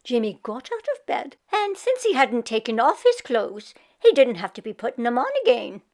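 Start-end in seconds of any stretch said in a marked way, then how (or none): none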